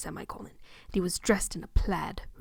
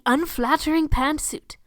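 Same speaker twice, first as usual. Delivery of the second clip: ordinary delivery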